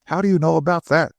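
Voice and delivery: fast, high, flustered stammer. A narrator's reading. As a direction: blurt it out, caught off guard. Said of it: "stammered Hay".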